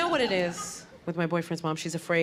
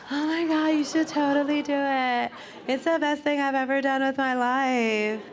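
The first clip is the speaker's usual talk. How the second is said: cult-like pregnant friend; 7 semitones higher than usual. Retold or imitated. Pregnant girlfriend recruiting her like a cult, gushing. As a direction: sell it like a cult recruiter, over-eager. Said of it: "Where it's like".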